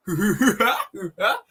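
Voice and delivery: high mock giggle; Vegan Gays laugh. Streamer imitating the activist between lines, mock high laugh. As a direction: put on a silly, exaggerated high laugh. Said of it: "working on my Vegan Gays impression".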